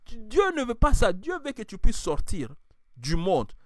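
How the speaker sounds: full-force sermon delivery; fiery preaching. Acted. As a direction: rouse the congregation.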